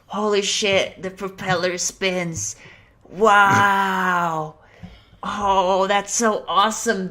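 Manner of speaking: put-on high, loud kid amazement; amazed kids. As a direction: gush over the spinning toy. Other kids amazed, high far voice.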